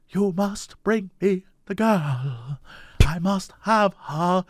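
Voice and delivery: soft, slow hushed villain; lightly voiced hushed villain; quiet villain voice. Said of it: "Like that".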